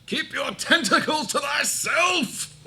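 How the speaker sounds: high, loud theatrical scream; screamed battle cry. Acted. Blade screaming at Mister Grabby, high theatrical shout. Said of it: "it will scream out".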